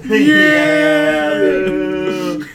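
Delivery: very loud, high, drawn-out whoop; excited cheer. Real, not acted. Host cheers on two future college roommates, loud hype. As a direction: holler it like a hyped fan.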